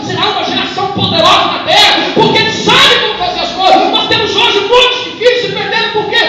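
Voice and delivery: loud, rising fervent sermon; full-force preaching. Acted. Pastor urging the church about future generations, full-force preaching. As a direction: preach it out, building to a shout.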